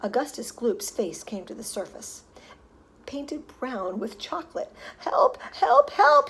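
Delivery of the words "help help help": high, loud desperate cries; yelling for help. A narrator's reading. Boy in the chocolate river, yelling for help. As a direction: cry out as if drowning. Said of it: "he yelled".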